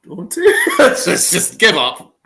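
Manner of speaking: high, loud, laughing disbelief; exasperated laughing. Real, not acted. Fan giving up on hitters facing the curveball, despairing laughter. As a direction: laugh helplessly through the words, pitch shooting up.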